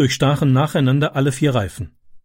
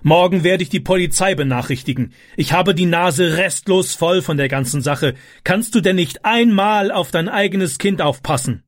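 scolding stepfather; 6 semitones higher than usual. A narrator's reading. Vater schimpft mit Mutter.

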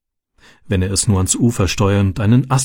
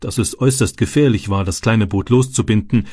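plain reading voice

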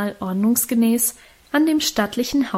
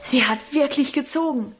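calling from afar; 4 semitones higher than usual. A narrator's reading. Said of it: "rief Lebrecht aus der Ferne".

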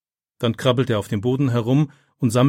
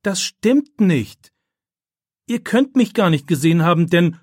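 outraged protest; 7 semitones higher, 6 dB louder than usual. A narrator's reading. Falsely accused pupil contradicts the witnesses, outraged protest. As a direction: protest the lie loudly, voice pitched up.

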